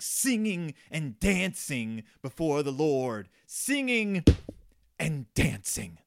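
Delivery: very slow, drawn-out impassioned quote; Kevin Bacon in Footloose. Retold or imitated. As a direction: hammer each word slowly, hammed-up movie speech. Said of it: "the part that Kevin Bacon talks about in Footloose".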